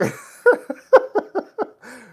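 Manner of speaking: high, loud burst of laughing; helpless laughter. Real, not acted. Streamer cracking up at a viewer's crude comment, helpless laughter.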